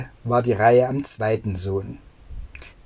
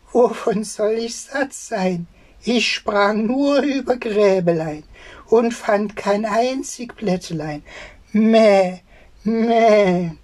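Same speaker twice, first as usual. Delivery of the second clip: high sing-song goat voice; bleating goat; 9 semitones higher than usual. Acted. Goat answering the old tailor in a high bleating voice. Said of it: "Und die Ziege antwortete".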